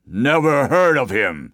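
slow, loud giant voice; forest giant. A narrator's reading. Giant dismisses the boaster's pursuer, slow loud giant. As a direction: pronounce it slowly and big, as a giant. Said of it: "said the Giant of the Forest".

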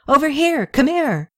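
high eager call; high call from a shadowed corner; calling over. Acted. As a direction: wave him over with your voice. Friend calling across tavern.

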